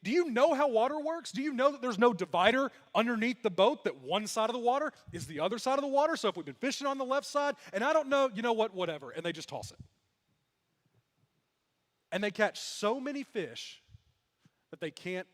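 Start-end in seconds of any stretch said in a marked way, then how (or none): 0.0-9.1 high-pitched, mock-exasperated rant; annoyed disbelief. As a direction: argue back irritated, then drop into a dismissive give-up. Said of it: "they are not exactly happy with him".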